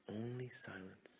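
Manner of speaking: ordinary delivery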